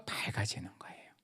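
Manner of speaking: soft hushed whisper; preacher whispering the answer. Real, not acted.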